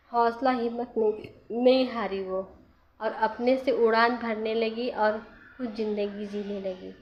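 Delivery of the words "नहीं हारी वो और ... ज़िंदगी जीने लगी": ordinary talk, nothing marked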